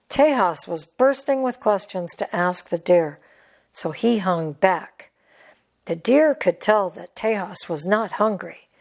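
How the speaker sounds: usual speaking voice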